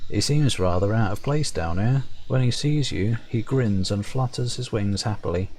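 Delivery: ordinary talk, nothing marked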